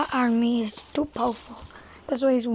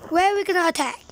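ordinary delivery

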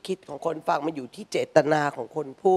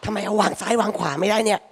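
irritated outburst; 3 semitones higher, 4 dB louder than usual. Retold or imitated. Mother fumes at Yakult bottles in fridge, loud exasperated outburst. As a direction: blow up at the badly placed bottles. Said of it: "ซึ่งเราก็คิด".

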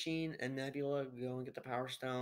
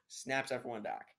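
ordinary talk

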